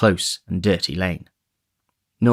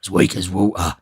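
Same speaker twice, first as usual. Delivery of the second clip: weak, grumbling sick man; a surly invalid cursing his weakness; sick surly robber. A narrator's reading. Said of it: "with an imprecation on his eyes".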